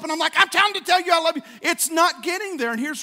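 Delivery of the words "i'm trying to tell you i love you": fast, high, loud exasperated plea; worked up. Acted. Husband trying to tell his wife, worked up. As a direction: blurt it out loudly, straining to get through. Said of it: "Why is he so worked up".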